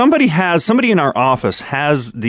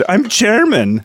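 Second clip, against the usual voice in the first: lightly performed quote, pitched higher; movie-line quote; 7 semitones higher, slower than usual. Acted. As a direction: deliver it like a quoted catchphrase. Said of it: "what's the line in Rock of Ages".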